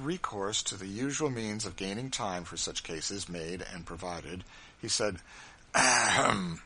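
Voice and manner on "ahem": throat clearing. A narrator's reading. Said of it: "and coughed several".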